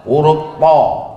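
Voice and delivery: slow emphatic "po"; slow letter demo; Arabic letter. Shown as a demonstration. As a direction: press out "po" slowly. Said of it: "Apalagi yang tak ada?".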